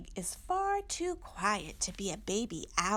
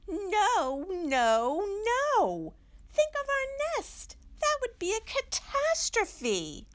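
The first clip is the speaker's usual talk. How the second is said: high, louder flustered protest; alarmed Mommy; 13 semitones higher, 6 dB louder than usual. Acted. Mommy protests an elephant in the nest, high flustered. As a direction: panic about the nest, hammed-up.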